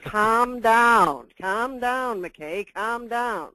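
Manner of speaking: laughing, playful mock soothing; teasing calm down. Speaker teasing McKay after a glitch, playful laughing. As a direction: tease him with a grin you can hear.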